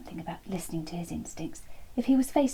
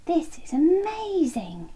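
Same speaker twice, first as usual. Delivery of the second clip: delighted wonder; 7 semitones higher, 5 dB louder, slower than usual. Acted. Chunky's realisation.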